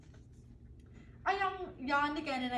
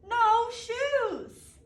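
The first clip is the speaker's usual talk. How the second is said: put-on loud, high toddler yell; shouting; 8 semitones higher, 7 dB louder than usual. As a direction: shout it like a defiant little girl.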